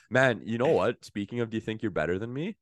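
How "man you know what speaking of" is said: ordinary talk, nothing marked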